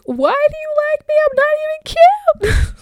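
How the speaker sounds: mock-whiny high voice; self-mocking whine. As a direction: squeak it out like a teen doubting herself. Retelling self-doubting question, high voice.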